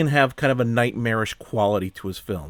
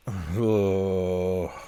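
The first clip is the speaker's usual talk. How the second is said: long exasperated exhale; exasperated sigh; 2 semitones lower than usual. Real, not acted. Reviewer fed up after a bad film, exasperated sigh. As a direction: heave a drawn-out, fed-up sigh.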